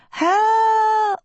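high, stretched-out scream; screamed cry for help. A narrator's reading. Agatha screams as her hands slip, desperate scream. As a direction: hold one long, high desperate cry. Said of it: "she screamed".

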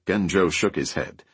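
usual speaking voice

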